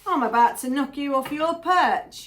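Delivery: loud, punched-up mock threat; emphatic threat. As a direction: throw the warning out forcefully. Repeats the threat with more force.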